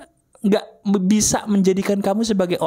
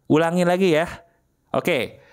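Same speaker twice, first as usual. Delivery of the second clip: normal talking voice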